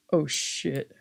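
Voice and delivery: ordinary talk, nothing marked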